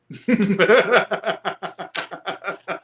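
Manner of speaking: high laughter bursting out; real burst of laughter. Real, not acted. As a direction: crack up for real at the comments. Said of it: "y'all making me laugh".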